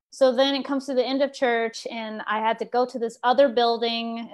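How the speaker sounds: ordinary talk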